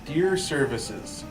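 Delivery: ordinary talk, nothing marked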